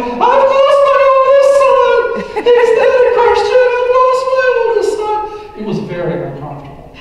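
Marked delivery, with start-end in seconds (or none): 0.0-5.6 hammed-up grieving mother, half-sung lament; imitating mom sobbing. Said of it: "we could hear her in the bedroom sobbing".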